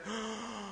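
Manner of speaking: sudden high breathy gasp; sharp gasp. Retold or imitated. Man gasping as the demon leaves him during prayer, sharp gasp. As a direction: imitate a startled intake of breath. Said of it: "he just went".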